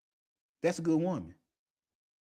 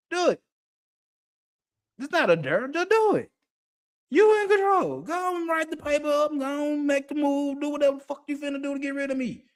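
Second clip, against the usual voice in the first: pitched-up, challenging comeback; defiant dare; 5 semitones higher than usual. Acted. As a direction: dare them to act, voice held high. Acted dare to complainer at job.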